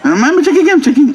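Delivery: very high mock protest; harried doctor. Doctor to nagging mother while checking, high-pitched harried protest. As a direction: imitate a doctor being badgered.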